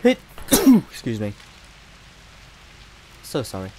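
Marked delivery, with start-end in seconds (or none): 0.0-0.8 loud, high sneeze; real sneeze. Real, not acted. Player sneezing in the middle of the game, loud real sneeze. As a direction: sneeze explosively after a short catch. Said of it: "I'm going to sneeze".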